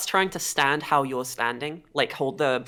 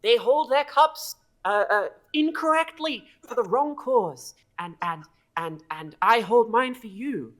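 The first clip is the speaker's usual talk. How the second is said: high, stammering devotee; eager kobold; 7 semitones higher, 4 dB louder than usual. Acted. Kobold urging a new master against the pretender, eager. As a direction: plead the case in an earnest, raised voice. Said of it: "very eager to share this knowledge with you".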